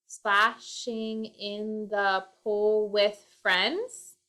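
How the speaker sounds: slow, trying the line out word by word; slow syllable count. Shown as a demonstration. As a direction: sound out each syllable slowly while composing. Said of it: "has to have seven syllables".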